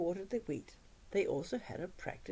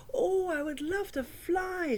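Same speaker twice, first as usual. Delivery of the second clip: dreamy excitement; 9 semitones higher, slower than usual. Acted. Mouse longing to fly.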